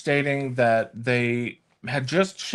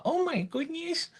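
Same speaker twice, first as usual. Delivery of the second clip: hammed-up grandma, higher and softer; 5 dB quieter than usual. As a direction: play up the little old lady. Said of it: "why do I sound like a grandma?".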